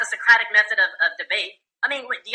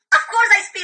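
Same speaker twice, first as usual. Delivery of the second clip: mocking quote; 11 semitones higher than usual. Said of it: "he's like".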